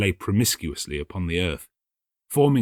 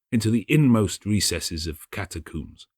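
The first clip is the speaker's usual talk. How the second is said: ordinary delivery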